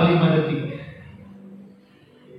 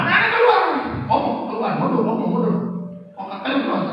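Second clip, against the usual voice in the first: a hint of a gruff uncle shooing kids off, first call much higher; uncle chasing kids; 12 semitones higher, faster than usual. Uncle shooing children from behind the truck, starting high. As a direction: call the kids away, then fade. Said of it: "Dia usir anak-anak".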